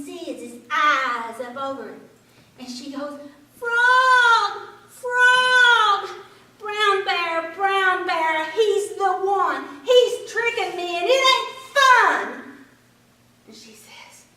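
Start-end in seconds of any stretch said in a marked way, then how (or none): 3.6-12.4 loud high call across the pond; rabbit calling out. A narrator's reading. Rabbit calls loudly to Frog across pond, high shout.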